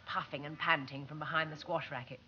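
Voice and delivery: ordinary delivery, nothing marked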